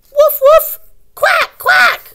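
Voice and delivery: high, loud woofs and quacks; bark, then quack like a duck; puppy and duck. Acted. Teacher reading, doing the puppy bark and duck quack.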